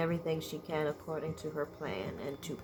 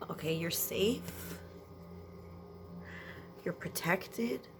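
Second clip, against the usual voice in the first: reassuring; 4 dB quieter than usual. Real, not acted. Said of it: "You need a lot of nurturing".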